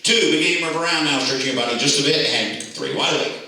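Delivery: bringing out of trance. Acted. Counting subjects out of trance.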